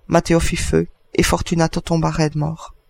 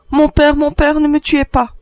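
put-on high, loud child begging for life; terrified pleading; 10 semitones higher, 6 dB louder than usual. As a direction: beg father in a high urgent voice. Child begs not to be killed. (supplication affolée d'enfant)

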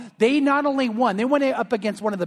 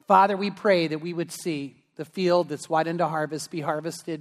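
earnest prayer; 4 semitones lower than usual. Real, not acted. Pastor closes with prayer.

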